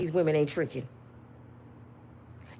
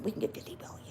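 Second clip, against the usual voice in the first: whispered echo of the plan; scheming whisper, repeated; 4 semitones lower, 15 dB quieter, much faster than usual. Retold or imitated. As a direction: repeat it under the breath. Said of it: "let me tell you what happened".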